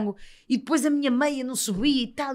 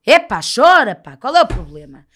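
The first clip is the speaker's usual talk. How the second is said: louder, coaxing call to let it out; loud, cheering on a friend to let go; loud urging; 12 dB louder than usual. Retold or imitated. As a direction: push her to cry, loud and bold.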